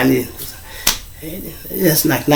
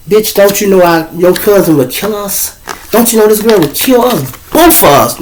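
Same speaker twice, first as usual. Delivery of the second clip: higher, urgent warning; mock alarm; 5 semitones higher than usual. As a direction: warn her with rising alarm. Retold argument with girl.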